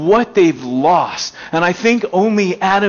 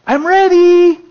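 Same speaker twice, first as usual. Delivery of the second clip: put-on much higher, louder child's call; toddler yelling; 14 semitones higher, 6 dB louder, slower than usual. Toddler playing hide and seek calls to daddy, high child yell. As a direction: call out like a little kid. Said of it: "they start yelling to you".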